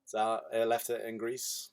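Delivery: slow, low attempt at Greek; badly faked Greek pupil making excuses; bad Greek accent. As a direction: softly fumble a Greek accent. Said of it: "I can't do a Greek accent".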